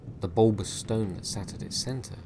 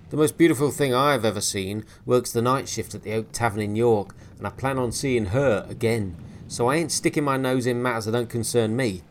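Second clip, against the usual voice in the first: loud, mocking, threatening retort; scornful sailor; 3 semitones higher, 9 dB louder than usual. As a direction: sneer it back, then warn him. Williams mocks and warns.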